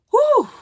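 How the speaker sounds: loud, high exhaled whew; heavy relieved "whew". Real, not acted. Streamer composing herself after upsetting news, big "whew". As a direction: blow out the emotion in one whoop of breath.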